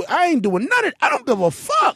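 very high squeaky outburst; squealing high. Real, not acted. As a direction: let the voice shoot up into a squeak. Peak of boastful rant.

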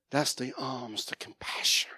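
breathy hushed whisper. Real, not acted. Preacher whispering the lesson to the congregation, hushed. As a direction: lean in and whisper the point. Whispered emphasis after the story.

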